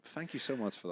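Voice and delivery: ordinary delivery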